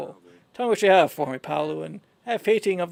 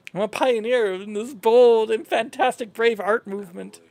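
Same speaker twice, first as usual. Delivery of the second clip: normal talking voice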